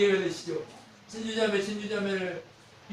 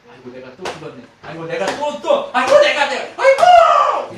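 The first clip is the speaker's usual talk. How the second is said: moan, then let it break into a hammed-up wail; lamenting believer, building; 7 semitones higher, 15 dB louder than usual. Preacher mimicking a failing believer, groaning up to a loud wail.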